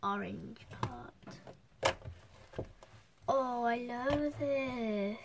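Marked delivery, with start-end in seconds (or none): 0.0-1.9 whisper. Real, not acted. Whispering while mixing slime.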